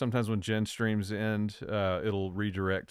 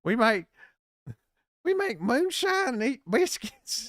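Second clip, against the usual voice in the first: high, loud mock Southern hillbilly voice; hammed-up Southern hillbilly; 5 dB louder than usual. Virginia native joking about his Southern accent, hammed-up high hillbilly voice. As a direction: ham up a Southern backwoods twang, pitched way up.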